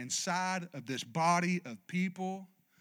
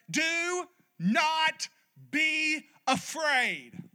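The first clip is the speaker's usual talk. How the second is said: angel's command; 9 semitones higher, much slower than usual. Acted. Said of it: "The angel said to her".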